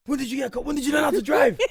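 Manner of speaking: high, loud amazed question; shocked disbelief. Retold or imitated. Younger brother finding out his brother has a car, loud amazed question. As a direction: sound floored that he has a car. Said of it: "I said".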